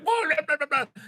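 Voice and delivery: high, mocking blah blah of upset fans; mocking whine. Streamer mocks overreacting fans with a high squeal. Mocking emotional fans' reaction.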